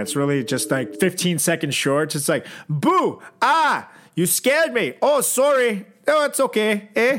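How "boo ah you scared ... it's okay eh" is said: 1 mock Canadian skit. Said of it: "all based in Canada".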